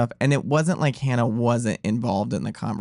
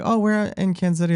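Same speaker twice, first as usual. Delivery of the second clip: lightly voiced eager sommelier; 7 semitones higher, faster than usual. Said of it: "she's like".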